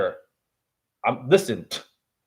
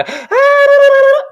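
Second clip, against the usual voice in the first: sharp, high, loud gasp; dramatic gasp; 8 dB louder than usual. Acted. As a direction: gasp as if shocked. Dramatic gasp mid rant.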